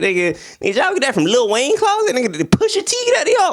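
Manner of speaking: high, fast joking outburst; mock incredulous. Reviewer roasting the hat, fast high joking. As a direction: rattle it off in mock shock. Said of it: "But I was like".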